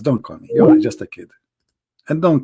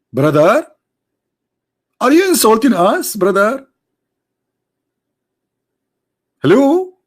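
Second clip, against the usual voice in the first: mock outrage; 9 semitones higher than usual.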